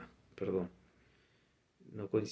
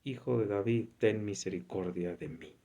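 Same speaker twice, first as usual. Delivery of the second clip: normal talking voice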